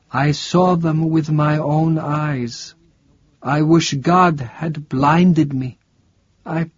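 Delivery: high, frantic voice of a delirious priest; a terrified priest seeing the devil; doomed ranting from a sickbed; raving old priest. Acted.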